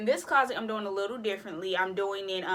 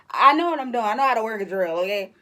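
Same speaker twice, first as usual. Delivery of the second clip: louder, defensive sass; 9 dB louder than usual. Real, not acted. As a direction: insist you know what you're doing. Defending her drill skills.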